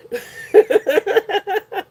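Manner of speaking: high laugh of relief; relieved laugh. Real, not acted. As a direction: laugh off the fright. Laughs after the scare.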